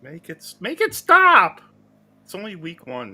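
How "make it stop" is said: high, loud, drawn-out wail; slow pleading yell; mock anguish. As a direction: cry out for mercy, hammed up. Mock despair over the team.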